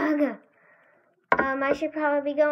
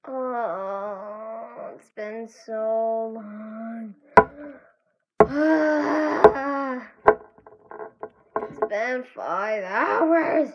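weary groan; 5 semitones lower, 4 dB louder, much slower than usual. Acted. Search drags on wearily.

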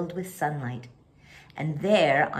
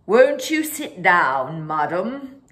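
polite waiter; 6 dB louder, slower than usual. Acted. Waiter greets them politely.

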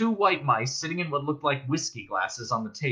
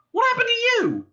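very high, loud demand; 6 dB louder than usual. A narrator's reading. Arthur confronts his friends at the table, demanding. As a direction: burst out demanding an explanation. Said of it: "demanded Arthur".

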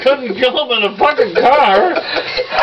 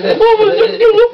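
mock shout; 12 semitones higher than usual. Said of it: "He goes, with his old glass".

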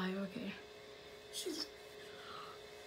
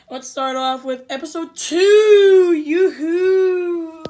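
loud, drawn-out sing-song call; cheery shout; 4 semitones higher, 10 dB louder, much slower than usual. Real, not acted. Gamer shouting out the episode start, loud and cheery. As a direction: call it out like a game-show host.

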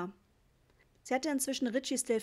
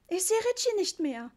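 high, loud alarmed mum; frightened mother's yell; panicked shout; 9 semitones higher, 5 dB louder than usual. A narrator's reading. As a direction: cry out that the child is lost. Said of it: "schrie Mama".

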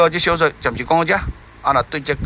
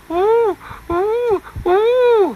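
high, slow wailing boo-hoo; a lightly mimicked sob; mock sobbing; much slower than usual. A narrator's reading. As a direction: imitate the fish weeping. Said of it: "一直哭".

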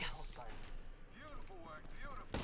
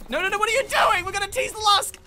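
very high, loud frantic yell; outraged protest; 11 semitones higher, 12 dB louder than usual. Real, not acted. Player protests what teammate is doing, outraged yelling. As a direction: scream at a teammate to stop.

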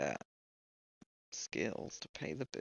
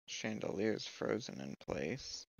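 ordinary talk

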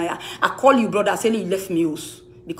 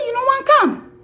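mock high squeak; 14 semitones higher, 3 dB louder than usual. Quoting Henrietta, big jump.

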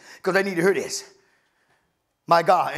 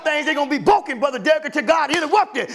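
preaching at full force; 5 semitones higher than usual. Acted. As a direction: whip the congregation up. Preaching climax, shouted.

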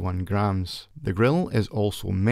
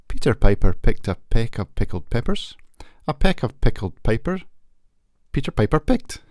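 loud plosive test; 7 dB louder than usual. Shown as a demonstration. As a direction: punch every P hard and loud into the mic. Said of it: "I'll now do a plosive test".

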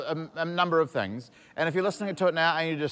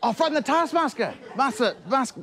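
excited shout; 10 semitones higher than usual. Real, not acted. Excited shout, misheard task.

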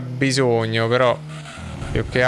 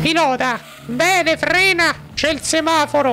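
high falsetto scolding wife; squeaky high woman, telling him off; Gustava voice; 13 semitones higher than usual. Acted. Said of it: "cambia voce la Gustava".

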